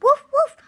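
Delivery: put-on the puppy barking happily at the old man; puppy barks. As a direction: yap like an excited little puppy.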